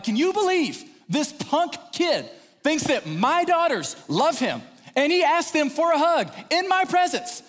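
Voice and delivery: high, loud comic outrage; worked up. Retold or imitated. Dad ranting to wife about a boy, worked up. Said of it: "why are you so worked up".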